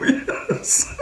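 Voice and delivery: a breathless laughing yes; laughing as she admits the big bite; laughing admission. Real, not acted. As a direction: say yes while laughing at yourself.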